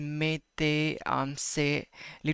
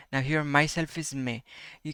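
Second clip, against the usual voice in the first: usual speaking voice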